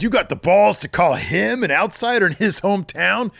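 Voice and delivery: scolding Logan in the ring; angry promo. Retold or imitated. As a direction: rant at him like an angry heel. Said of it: "And he says".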